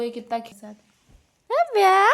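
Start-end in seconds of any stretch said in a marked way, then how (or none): 1.5-2.1 calling out. Real, not acted. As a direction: call her name brightly across the room. Calling the baby's name. (बच्ची को ऊँची आवाज़ में पुकारना)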